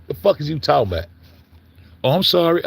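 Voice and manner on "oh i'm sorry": voicing the chatbot's apology, higher; a sugary fake sorry; imitating Gemini saying sorry; mock apology. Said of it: "this bitch to apologize to me".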